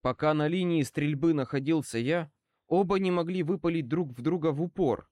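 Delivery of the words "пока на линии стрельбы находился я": ordinary delivery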